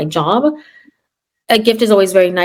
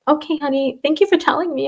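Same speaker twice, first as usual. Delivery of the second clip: soft reassuring teacher; 6 semitones higher, 4 dB quieter than usual. Retold or imitated. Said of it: "And I'm like".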